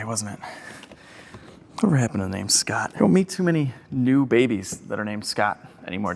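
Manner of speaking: usual speaking voice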